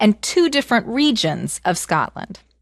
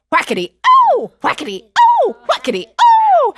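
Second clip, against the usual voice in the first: each oh higher and louder; rising yelps; 8 dB louder than usual. Acted. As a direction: build the cries of pain. Beating with cries.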